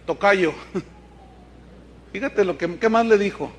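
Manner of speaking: usual speaking voice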